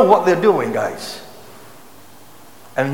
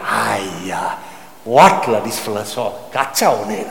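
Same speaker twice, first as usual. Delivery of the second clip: low, exasperated Malaysian English grumble; singlish; 9 semitones lower than usual. Retold or imitated. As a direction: grumble like a local fed up. Annoyed visa applicant finds embassy moved.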